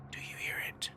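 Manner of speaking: very soft, unvoiced-sounding close whisper; frightened whisper. A narrator's reading. Hutch leaning close in the back seat, whispering. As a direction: whisper it secretively, barely audible. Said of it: "leaned close and whispered to me".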